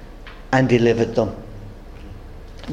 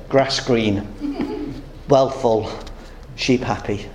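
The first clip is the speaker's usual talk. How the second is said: a lightly voiced Indian, pitched lower and unhurried; the Indian's voice; 5 semitones lower, 4 dB quieter, slower than usual. Preacher voicing the Indian showing his gold, lightly lower. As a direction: give the Indian's calm answer, a touch lower. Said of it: "The Indian then said".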